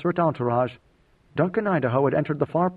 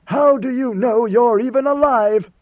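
loud, high, slow demand; raised voice; 9 semitones higher, 7 dB louder, slower than usual. A narrator's reading. God Emperor challenges the women, raised voice. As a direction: demand an answer, voice raised and drawn out. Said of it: "Raising his voice, he demanded".

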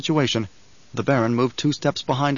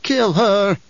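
put-on old woman; 7 semitones higher, 4 dB louder than usual. A narrator's reading. Old woman repeats command.

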